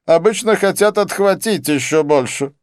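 high, loud smug retort; smugly knowing, pitched high; self-satisfied. A narrator's reading. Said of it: "заметил он с самодовольным видом".

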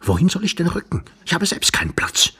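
high-pitched, testy retort; irritated stranger. A narrator's reading. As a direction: snap back in a high voice.

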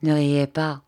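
usual speaking voice

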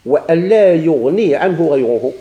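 loud, measured reading of a rule; slow rule recitation. Shown as a demonstration. Teacher citing the next condition before explaining it, slow recitation. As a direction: state it slowly and firmly for students.

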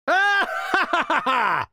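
slow, very high gloating laughter; gleeful high cackle. Acted. King gloats at his cousin's miss, high slow cackle.

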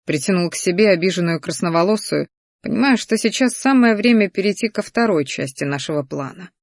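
plain reading voice

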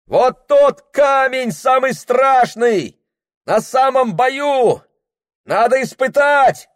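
high, slow shout over roaring water. Acted. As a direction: yell over the noise of the rapids. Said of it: "кричал Прохор". (крик сквозь грохот воды)

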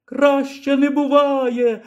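high, drawn-out happy call; calling back. Acted. Lyra answers the old man from the rising basket, happy high call. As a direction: shout back with joy. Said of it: "гукнула Ліра у відповідь".

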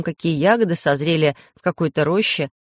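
usual speaking voice

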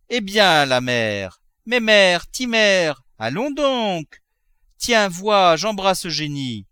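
high, loud, drawn-out coaxing; anxious coaxing. Acted. Husband coaxing his fainting wife awake, anxious coaxing. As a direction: rouse her with eager endearments.

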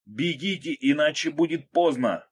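high, strained, loud urging; cracking hoarse shout. A narrator's reading. Narrator urging others to flee, cracking hoarse shout. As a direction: shout until the voice breaks. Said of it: "сорвавшимся голосом хрипел я".

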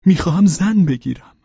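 high, loud and eager; excited announcement. Acted. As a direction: burst out with the happy news. Pavel announces his marriage plan. (با هیجان، بلند و زیر)

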